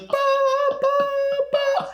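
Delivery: high, spaced bursts of mimicked alarm; imitated alarm blasts. Friend imagining alarm over push to talk, imitated blasts. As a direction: blare in short cut-off bursts. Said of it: "Could you imagine push to talk".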